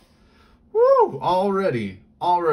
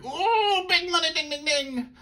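excited cheer; 3 dB louder than usual. Real, not acted. Celebrating a good pull.

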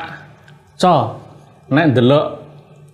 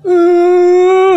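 high, loud, drawn-out keen; one long, held high wailing note; Semar's mock wail; 5 dB louder than usual. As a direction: imitate a puppet crying without real tears. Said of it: "Kaya wong nangis ning jane ora nangis".

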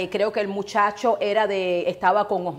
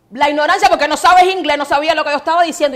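louder, higher exasperated outburst; real irritation, raised voice; indignant rant; 6 semitones higher, 9 dB louder, faster than usual. Real, not acted. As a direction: vent the frustration. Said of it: "indignant rant".

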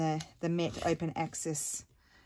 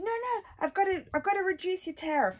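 mock salesman; 14 semitones higher than usual. Mocking salesman at door.

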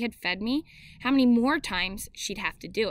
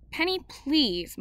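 drawn-out, higher, slow plea; pleading call; 6 semitones higher, slower than usual. A narrator's reading. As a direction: call over the noise, begging. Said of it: "Mom cried out".

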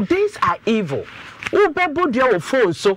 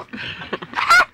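high burst of laughter. Real, not acted. Host laughs again.

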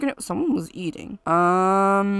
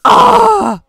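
excited cry; 13 semitones higher, 13 dB louder than usual. Real, not acted. Baby born.

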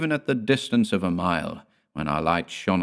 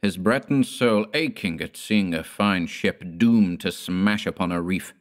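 usual speaking voice

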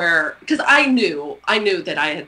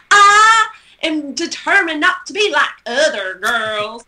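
loud, slow, exaggerated Texas twang; hammed-up Texas drawl, high and loud; Texas twang shouting; 8 semitones higher, 6 dB louder than usual. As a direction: belt it out like a clueless kid projecting. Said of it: "just Texas twang and just ridiculous".

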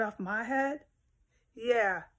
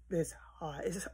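whisper; 3 semitones lower, 9 dB quieter than usual. Real, not acted. Complaining about the hot house.

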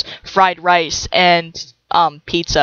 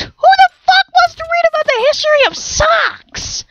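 incredulous; 3 dB louder than usual. Real, not acted. Indignant at sock book.

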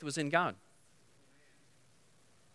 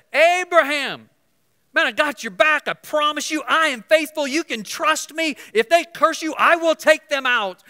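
loud, high, emphatic promise; God's voice; 12 semitones higher, 9 dB louder than usual. Acted. Preacher voicing God to Abraham, loud and high. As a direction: proclaim it like God reassuring Abraham.

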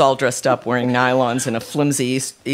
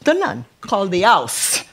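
higher-voiced outraged mother; 7 semitones higher than usual. A narrator's reading. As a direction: complain in scandalised outrage. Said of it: "Rita was still outraged".

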